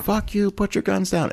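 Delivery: high, hushed mock yell; mock gangster standoff. Gangsters shouting each other down in a standoff, mock tough.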